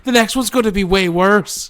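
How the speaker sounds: high, loud, indignant proclamation; put-on high, forceful character voice; Mother Superior. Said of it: "Thank you, Mother Superior".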